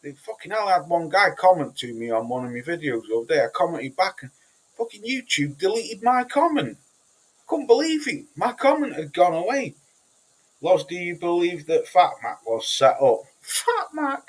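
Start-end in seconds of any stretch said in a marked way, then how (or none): 4.8-9.8 can't believe what YouTube did; incredulous outrage. Real, not acted. Telling his stream, pitched-up incredulous outrage. 13.4-14.2 a high, scoffing echo of the name; incredulous. Real, not acted. Repeating a viewer's question, high incredulous scoff.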